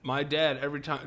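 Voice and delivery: usual speaking voice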